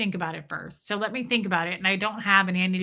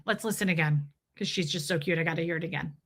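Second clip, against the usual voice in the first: ordinary talk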